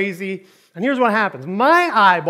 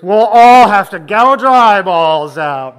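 loud, drawn-out comic dread; exclaimed warning; 3 semitones higher, 14 dB louder, slower than usual. Acted. Church member warning about letting Kim in, loud slow exclamation. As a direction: belt it out slowly as a dire warning.

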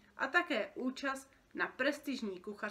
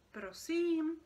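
higher, puzzled question; confused; 8 semitones higher, 4 dB louder than usual. Acted. Boy asking a staring creature, confused. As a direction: ask back, baffled. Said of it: "zeptal se zmateně Jáchym".

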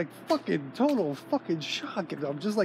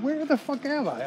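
much higher, incredulous retold cry; stunned disbelief; 9 semitones higher than usual. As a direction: disbelief shooting up high in pitch. Retold shock in skinhead bar.

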